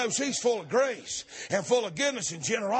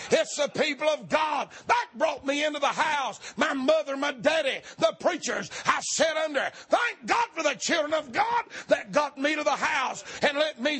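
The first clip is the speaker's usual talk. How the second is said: full-force preaching; 4 semitones higher than usual. Acted. Preacher voicing the woman's plea at full force. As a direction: shout the plea to the rafters.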